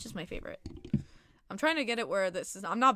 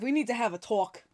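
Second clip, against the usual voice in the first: a touch of a stern talking-to; lightly playing the strict one; mock-serious; 5 dB louder than usual. Mock-serious call-out to a viewer.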